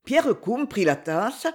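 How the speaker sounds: usual speaking voice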